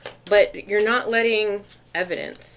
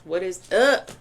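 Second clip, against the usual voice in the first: usual speaking voice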